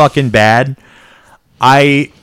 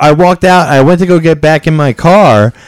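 ordinary talk

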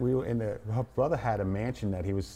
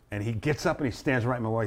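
ordinary talk, nothing marked

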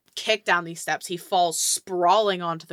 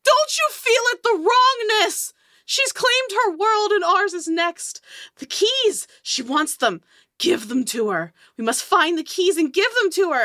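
crazed, high-pitched loud scream; screaming madman; 13 semitones higher, 6 dB louder than usual. Acted. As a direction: scream it like a raving doomsayer. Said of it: "starts screaming at the top of his lungs".

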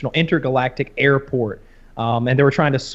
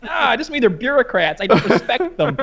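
a bit higher, offhand with a laugh; lightly imitating Rick; 8 semitones higher than usual. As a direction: toss it off again, half laughing. Rick line repeated.

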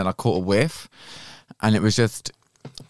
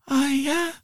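exaggerated moan. Mocking a sex noise.

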